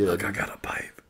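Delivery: hushed secret whisper. Retold or imitated. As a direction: whisper it like a dealer. Whispered dealer voice.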